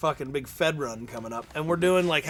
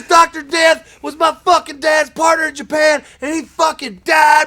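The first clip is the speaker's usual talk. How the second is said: much higher, very loud angry yelling; furious shout; 11 semitones higher, 11 dB louder than usual. Retold or imitated. Boss shouting back at Ray about his late father, furious yell. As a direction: roar it at him, outraged.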